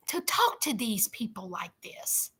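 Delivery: hushed, scornful whisper; disgusted whisper. Real, not acted. Disgusted commentary.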